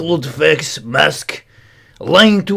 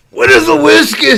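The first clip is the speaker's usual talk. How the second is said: voiced chat comment; 11 semitones higher, 5 dB louder than usual.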